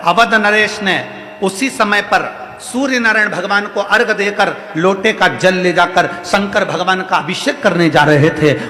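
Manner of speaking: usual speaking voice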